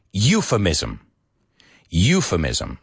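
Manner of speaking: euphemism as YOO-fuh-miz-um, stress first; model pronunciation. Shown as a demonstration. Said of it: "Say it with me".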